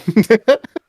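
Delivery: high burst of laughter. Real, not acted. Co-host laughing at the betrayal joke, high. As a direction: cackle at the joke.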